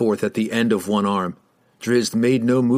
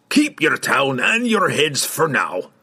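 slow, higher, gruff dwarf; defiant dwarf; 4 semitones higher, slower than usual. A narrator's reading. Dwarf spins back on riders, grudging defiance. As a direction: concede it slowly with a threat left in.

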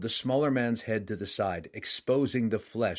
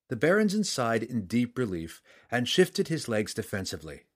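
ordinary delivery

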